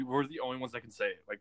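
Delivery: ordinary talk, nothing marked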